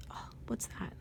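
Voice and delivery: hushed curious whisper. Acted. Reading to a child, a hushed curious whisper. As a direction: whisper it like a discovery. Noticing something in the book.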